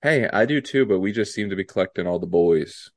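ordinary delivery

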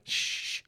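long unvoiced "shh"; drawn-out shush. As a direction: hush someone in a theater. Quoting Nancy's shushing line.

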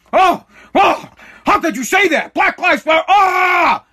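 high, loud mocking whine of outrage. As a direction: parody the offended crowd. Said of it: "Everybody gets pissed".

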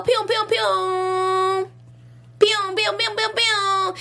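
high, slow gunshot pew pews; high sound effect. Pretend. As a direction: squeak out high laser gun noises. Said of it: "my sound effect".

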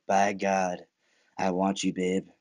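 low, slow, lovestruck mutter; smitten Tommy. Retold or imitated. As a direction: drop the voice low like a man instantly smitten. Imitating Tommy's smitten reaction.

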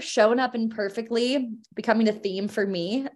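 ordinary delivery

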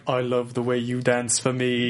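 slow, smooth British agent voice; British Secret Service guy. Retold or imitated. Improv spy speaking to dancing girl, smooth British voice. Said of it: "I was the British Secret Service guy".

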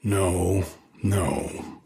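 stricken, softly broken; a stricken, hushed no; in shock. Acted. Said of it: "said the stricken voice".

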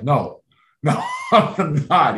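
a laughing no, voice up a touch. Retold or imitated. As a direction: laugh through the denial. Teammate asks if he's alright.

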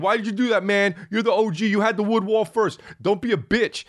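high, whiny mock complaint; whiny fans. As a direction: imitate annoyed fans nagging. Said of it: "people were like".

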